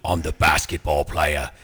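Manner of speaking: deadpan hard-man growl as the action star; Jason Statham impression. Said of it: "he might as well be like".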